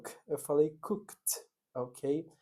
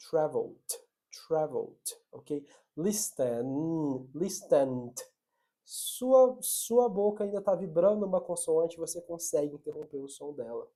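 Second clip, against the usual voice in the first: ordinary delivery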